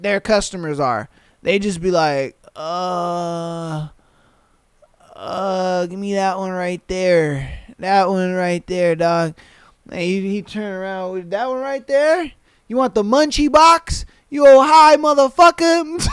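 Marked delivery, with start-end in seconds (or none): 2.6-9.3 lower, dragged-out dopey ordering; imitating a stoned customer. Imitating high drive-thru customer. 11.3-16.1 mock worker comeback. Worker mocking the customer.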